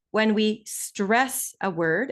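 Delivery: "stress" drawn out slowly. Shown as a demonstration. Teacher demonstrating word stress to students, slow emphatic "stress". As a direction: lean on "stress" and drag it out. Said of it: "as I just demonstrated".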